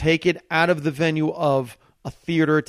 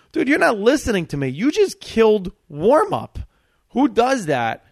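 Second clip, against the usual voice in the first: much higher and louder, fast then slowing; excited disbelief; 8 semitones higher, 5 dB louder than usual. Retold or imitated. The host insisting the comic just killed warm-up, excited. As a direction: gush like you can't believe it. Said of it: "what he meant by that was".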